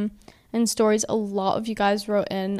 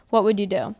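ordinary talk, nothing marked

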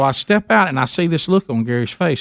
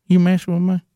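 Gary's voice; 5 semitones higher, faster than usual. Retold or imitated. Gary voiced.

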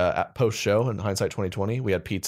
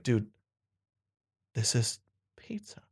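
slow, awed mock whisper; acted stunned whisper; hushed realization; 5 dB quieter than usual. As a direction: whisper it like a big revelation. Acted hushed realization over food.

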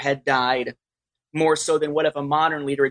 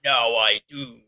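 deep voice changer effect; toy voice changer; 5 semitones lower, slower than usual. Acted. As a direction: lower Vader voice through a toy changer. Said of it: "playing with a toy voice changer".

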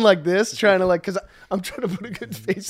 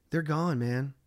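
low, soft retold reassurance; calming; 4 semitones lower, 8 dB quieter, slower than usual. Reassuring friend to keep the trip positive, calming. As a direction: gently settle a worried friend, keep it low. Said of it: "trying to keep the trip still in a positive place".

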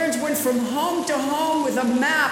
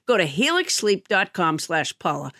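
ad read; 8 semitones lower than usual. Acted. Sponsor ad read.

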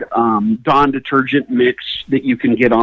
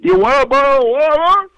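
high, garbled mock question; can't talk properly; 10 semitones higher than usual. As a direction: mumble it high, words smeared together. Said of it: "I can't talk very good".

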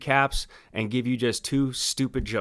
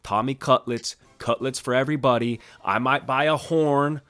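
ordinary delivery

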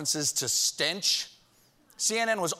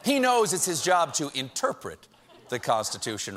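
ordinary delivery

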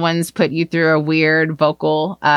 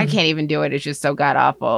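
ordinary talk, nothing marked

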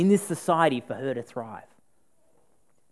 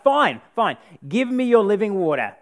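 loud, higher, fed-up snap; snapping; 6 semitones higher, 8 dB louder than usual. Retold or imitated. Preacher voicing the woman snapping back at Jesus, loud and fed up. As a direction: bite it off like she's had enough. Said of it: "the woman snaps".

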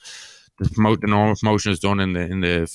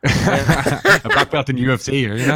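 laughing; 6 semitones higher, slower than usual. Real, not acted.

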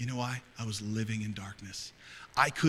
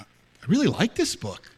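higher, louder gushing; surprised coworker; 6 semitones higher, 9 dB louder than usual. Retold or imitated. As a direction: gush it like a delighted reader. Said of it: "They're like".

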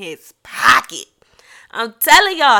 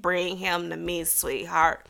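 low, soft grandmother impression; drawling Nana; 5 semitones lower, 7 dB quieter than usual. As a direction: coax softly in Nana's low voice. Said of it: "she talk like that".

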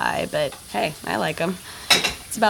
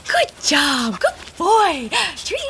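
talking to a dog; 8 semitones higher, much slower than usual. Acted. Praising the dog.

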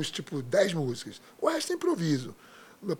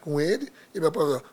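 usual speaking voice

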